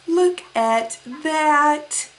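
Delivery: ordinary talk, nothing marked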